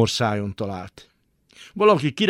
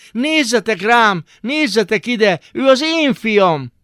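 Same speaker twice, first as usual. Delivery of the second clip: very high, loud, slow cry; shouting; 10 semitones higher, 6 dB louder, slower than usual. Acted. Father shouting to the bath attendants, high loud cry. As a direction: cry out for everyone to look.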